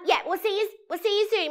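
usual speaking voice

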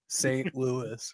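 quiet, level insert with no crowd energy; dead-air splice. Retold or imitated. Mimicking the CD after crowd noise vanishes, quiet spliced-in voice. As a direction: drop it in softly like a bad edit. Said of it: "they literally cut the track".

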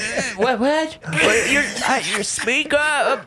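high, slow feeble dying Englishman. Retold or imitated. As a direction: croak like a dying medieval peasant. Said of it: "do my dying English voice".

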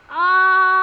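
long high scream of fright; loud scream. Pretend. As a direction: scream as you fall. Still falling, calls for help.

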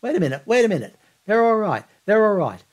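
loud, high, holding back the cop; urgent call. Retold or imitated. Brown calls out to the cop, loud and urgent. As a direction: raise your voice to stop him. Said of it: "Brown catches him".

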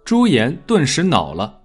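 ordinary delivery

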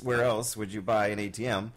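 ordinary talk, nothing marked

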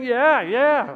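excited spectators shouting yes; cheering crowd. Retold or imitated. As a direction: yell it like a crowd egging him on. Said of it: "they're all cheering".

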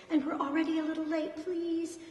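high, coaxing plea; wheedling. A narrator's reading. Pat coaxes the sales clerk, wheedling. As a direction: sweeten it up to get past the clerk. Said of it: "she wheedles".

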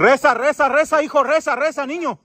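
urgent pleading. Real, not acted. Frantically urging child to pray. (súplica urgente e insistente)

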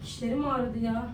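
normal talking voice

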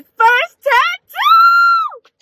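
loud, drawn-out hype shout; excited shout. Real, not acted. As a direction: announce it like a big event. Answer to guess what.

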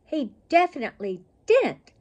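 loud, emphatic refusal; forceful storyteller's denial; terrifying tone. A narrator's reading. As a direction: hit "definitely" and "didn't" high and hard. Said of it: "in a terrifying tone of voice".

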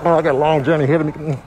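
ordinary talk

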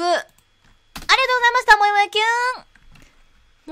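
high, loud cutesy maid; bubbly maid farewell chant; maid cafe voice. Pretend. Maid-themed shop cashier.

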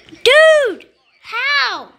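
high disbelieving yell; excited shout. Real, not acted. Outraged at game.

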